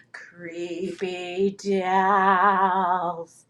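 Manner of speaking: low, hugely drawn-out ghostly voice; spooky drawl. Pretend. Seller shows off doll leggings, low spooky drawl. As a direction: stretch each word out eerily, pitched low.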